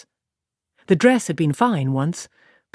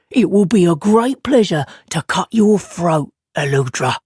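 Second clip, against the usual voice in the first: louder, relishing threat; gloating killer; 4 dB louder than usual. Acted. Tammuz with a knife, promising to kill Aludra. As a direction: savour the promise of murder.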